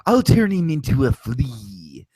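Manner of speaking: scheming villainess, whispers building to high cackling glee; Yzma impression. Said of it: "can I do a Yzma monologue".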